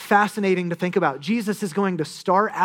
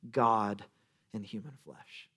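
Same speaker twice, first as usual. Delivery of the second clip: hushed reveal; 6 semitones lower, 9 dB quieter, slower than usual. Real, not acted. Climactic reveal of God.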